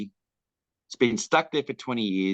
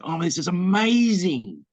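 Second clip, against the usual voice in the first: higher, drawn-out gush; imitated starstruck wonder; 9 semitones higher, 4 dB louder, slower than usual. Newcomer dazzled by Hollywood lights, gushing slowly and high.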